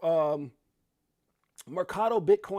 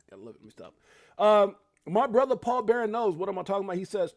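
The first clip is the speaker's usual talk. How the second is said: ordinary delivery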